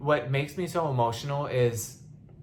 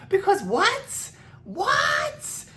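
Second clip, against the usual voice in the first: high, slow shout of what; incredulous outrage, voice jumping up; furious disbelief; outraged; 4 dB louder, much slower than usual. Real, not acted. Outraged rhetorical outburst.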